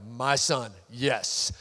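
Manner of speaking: low, solemn fatherly God; voice of the Lord. Acted. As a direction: answer as God, pitched well down. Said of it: "And the Lord's like".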